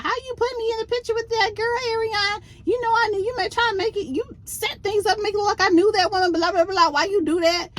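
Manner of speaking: high, fast mock of an indignant man; imitating Nell's rant. Host mocks Nell confronting Martell, high-pitched mock rant.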